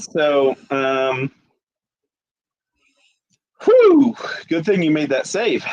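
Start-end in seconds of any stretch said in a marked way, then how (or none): 3.6-4.2 relieved whew. Real, not acted. GM relieved after the save.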